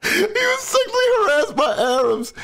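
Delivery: high laughing quote; laughing mock quote. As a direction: squeal the line through laughter. Quoting clip, laughing high.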